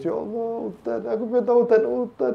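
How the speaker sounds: high, whiny mock sob over the rain; exaggerated tearful lament; mock weeping. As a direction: wail it in a thin high voice, hammed up. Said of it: "Udan nangis".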